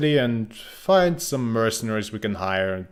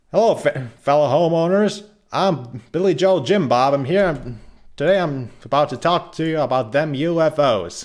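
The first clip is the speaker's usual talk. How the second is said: higher, louder put-on hillbilly accent; 4 semitones higher, 4 dB louder than usual. Presenting to his English class as a hillbilly, in a hammed-up hillbilly accent. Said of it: "put on my best hillbilly accent".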